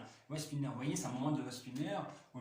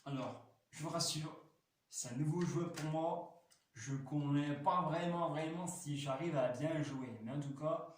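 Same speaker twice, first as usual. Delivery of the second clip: normal talking voice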